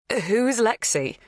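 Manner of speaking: baffled. A narrator's reading. Kate baffled.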